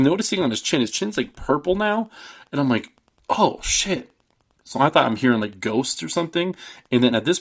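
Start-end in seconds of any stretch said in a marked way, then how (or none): 3.3-4.1 high, startled "oh, shit"; mock shocked reaction. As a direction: act like a jolted viewer. Reacting to the movie scene.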